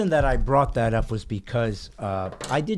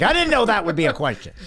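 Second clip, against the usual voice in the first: mock flustered; 8 semitones higher, 5 dB louder, much faster than usual. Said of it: "He's like".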